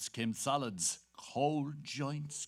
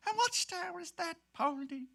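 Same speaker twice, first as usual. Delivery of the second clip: wife's voice. Retold or imitated. Quoting the wife's question.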